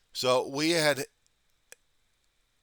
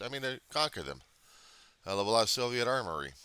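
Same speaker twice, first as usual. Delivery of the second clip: ordinary talk, nothing marked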